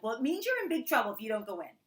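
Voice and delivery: fast, high, loud mock warning; exasperated parent. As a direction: rattle off a retold threat to a child. Retold warning to her child.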